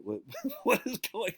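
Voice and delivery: high bewildered laugh; laughing confusion. Retold or imitated. New freshman baffled by the bully, high laughing disbelief.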